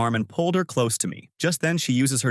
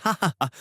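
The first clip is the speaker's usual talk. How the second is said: gloating laugh; 7 semitones higher, much faster than usual. A narrator's reading. Gloating about escape plan.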